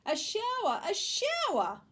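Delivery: high, loud squeal stretched on the repeat; children's excited scream. A narrator's reading. Four children see the hose shower, screaming. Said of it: "screamed Missus Ralph's four children".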